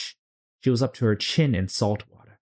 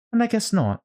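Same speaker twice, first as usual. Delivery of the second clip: Alice voice; 13 semitones higher, 3 dB louder than usual. Acted. Alice answering.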